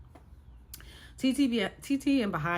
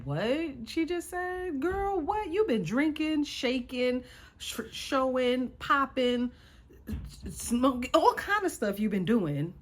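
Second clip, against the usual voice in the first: much higher, stunned, mock-outraged voice; incredulous disbelief; 7 semitones higher, slower than usual. Ranting at a reality star on TV, shocked and incredulous. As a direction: talk back to the TV in shock. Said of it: "I looked at the T V like".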